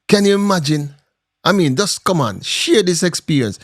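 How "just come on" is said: ordinary talk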